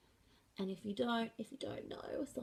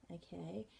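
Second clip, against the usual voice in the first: usual speaking voice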